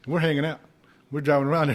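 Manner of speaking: usual speaking voice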